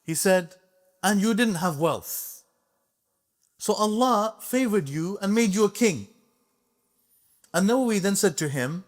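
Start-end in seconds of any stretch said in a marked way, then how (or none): 1.0-6.1 higher, firmer scholar confronting the king; bold rebuke. Retold or imitated. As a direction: speak up boldly to the sultan. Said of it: "he spoke to him like this".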